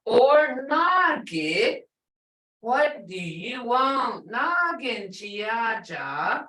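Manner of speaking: Nagi said slowly with a rising tone; slow demo. Shown as a demonstration. Teacher slowly demonstrating nagi to learners. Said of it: "Hear it?".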